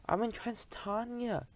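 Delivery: high, slow, thin squeak; a frightened little squeak; squeaky voice. Acted. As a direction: squeak it out small and scared. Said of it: "he squeaked".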